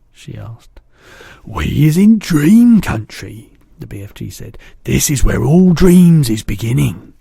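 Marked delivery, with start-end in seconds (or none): 1.5-3.5 loud, slow friendly giant. A narrator's reading. BFG telling Sophie where they are, booming. 4.8-7.1 giant voice. A narrator's reading. BFG telling Sophie where they are, booming.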